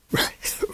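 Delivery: breathy wheezing laugh; a frail gasping chuckle; gaspy old chuckle. A narrator's reading. Ancient lady laughing at the boy's story, gaspy. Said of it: "It was a gaspy sound".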